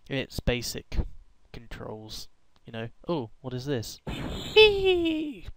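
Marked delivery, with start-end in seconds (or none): 4.1-5.5 high little squeal; short high hee; gleeful squeal. Real, not acted. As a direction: squeak with glee. Squeal at discovery.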